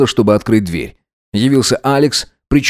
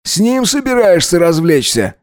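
high-pitched accusing question; jealous accusation; 7 semitones higher than usual. A narrator's reading. Alex accuses his lover over Max, jealous and needling.

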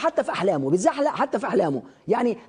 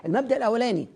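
normal talking voice

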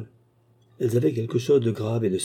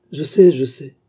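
eager outburst; 5 semitones higher, 8 dB louder than usual. Acted. Master recognizes him, embraces him.

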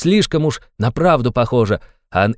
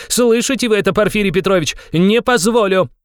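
furious outburst; 8 semitones higher than usual. A narrator's reading. Raskolnikov to Porfiry Petrovich, furious high outcry. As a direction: hurl the refusal at him.

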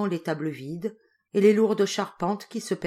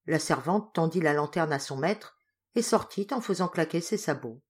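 ordinary delivery

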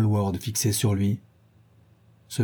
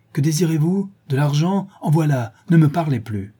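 high, raised voice, impatient questions; exasperated outburst; 6 semitones higher, 4 dB louder than usual. A narrator's reading. Dorian tries to buy the woman's silence, exasperated and raised. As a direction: keep crying out, pitched up and insistent.

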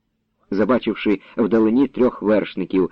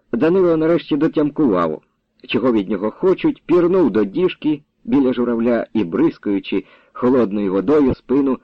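ordinary delivery

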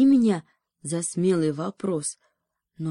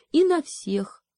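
pitched up high, indignant add-on; high outraged cry; 9 semitones higher, slower than usual. Acted. Заяц возмущённо выкрикивает следом. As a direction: fling it out, scandalised.

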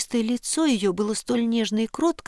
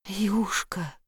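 soft, hushed near-whisper; 5 dB quieter than usual. A narrator's reading. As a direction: say it under the breath, as the girl in the story. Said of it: "прошептала девушка". (тихо, почти шёпотом)

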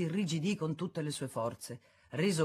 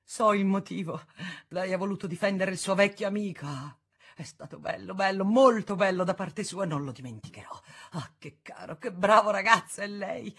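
tearful joy; 4 semitones higher, 5 dB louder than usual. A narrator's reading. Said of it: "versava delle lacrime e ... rideva di gioia".

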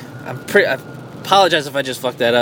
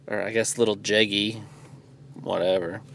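much softer throwaway aside; muttered aside; 5 semitones lower, 10 dB quieter than usual. Real, not acted. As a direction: drop it under your breath.

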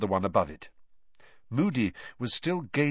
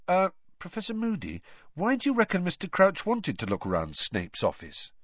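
higher-pitched, hesitant questioning; Harry, tentative schoolboy; 9 semitones higher than usual. A narrator's reading. Harry asking Professor Moody about Crouch, tentative. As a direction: ask the teacher carefully, in a higher voice.

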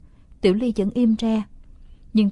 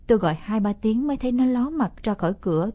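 ordinary delivery, nothing marked

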